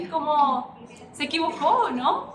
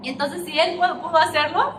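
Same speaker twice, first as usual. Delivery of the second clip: climbing higher and louder to a rally cry; rousing build; 4 semitones higher, 5 dB louder than usual. Real, not acted. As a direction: lift the congregation to its feet. Preacher builds to rallying call.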